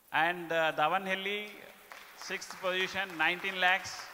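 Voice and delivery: loud, upbeat awards announcer; award announcement. Acted. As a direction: announce the winners to the room. Announcing top states and branches.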